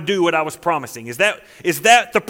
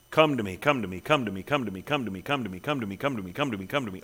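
much lower and softer, a soft pleading murmur; gentle repeated plea; 8 semitones lower, 8 dB quieter, faster than usual. Acted. Jesus calling sinners to come, soft and low. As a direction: coax them in, barely above a hush, over and over. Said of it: "over and over and over".

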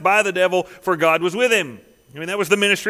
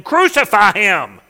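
louder, slow, drawn-out mock yell; crowd shouting; 3 semitones higher, 7 dB louder, slower than usual. The crowd demanding Pilate crucify Jesus, slow mock shout. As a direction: call it out like an angry mob. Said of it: "they kept shouting all the more".

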